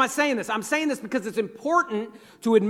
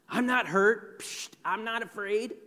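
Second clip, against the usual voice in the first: dismissive denial; 8 dB quieter than usual. Acted. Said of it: "not admitting that".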